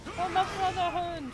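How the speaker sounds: high shouted war cry; rally the troops, yelling high; battle cry. Pretend. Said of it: "battle cries during fight".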